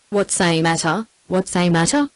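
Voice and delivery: a lightly voiced sharp old employer; fussy old boss, rising on matter; sharp old man demanding what the matter is. A narrator's reading. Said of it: "in his old man sharp voice".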